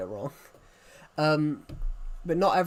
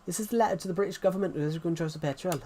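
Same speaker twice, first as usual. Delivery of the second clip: quick, higher-pitched Welsh impression; Welsh accent; 4 semitones higher, faster than usual. Streamer showing what the Welsh accent is like, mock Welsh accent. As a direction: put on a lilting Welsh accent, hurried. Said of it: "Welsh accent's a bit like this".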